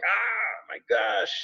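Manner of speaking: high, hammed-up exasperated cry; mock exasperation. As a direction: play the stressed worker losing it over barking dogs. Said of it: "I can imagine you're like".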